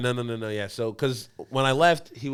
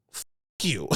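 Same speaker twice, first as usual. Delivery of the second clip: a voiceless muttered fuck you; whispered curse. Retold or imitated. Retold whisper at the nurse, under the breath. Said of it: "I'm just like".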